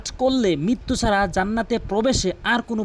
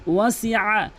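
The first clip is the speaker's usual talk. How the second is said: slow, melodic chanted recitation; Quran recitation; much slower than usual. Shown as a demonstration. Teacher recites for students, slow melodic chant. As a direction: chant the verse slowly with long held vowels.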